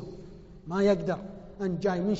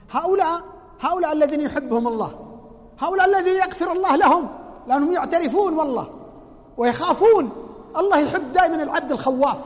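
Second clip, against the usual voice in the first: higher, louder fervent preaching; 5 semitones higher, 8 dB louder than usual. Real, not acted. Preacher praising the God-fearing to the congregation, louder. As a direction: preach it out with conviction.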